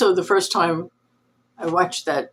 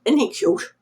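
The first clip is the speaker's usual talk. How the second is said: high cooing pet voice, much higher; doting dog talk; 10 semitones higher than usual. Real, not acted. Showing off her dog to viewers, high doting coo.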